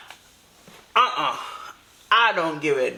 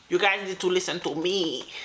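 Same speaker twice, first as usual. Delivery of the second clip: ordinary talk, nothing marked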